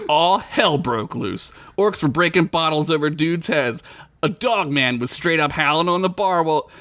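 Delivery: ordinary delivery, nothing marked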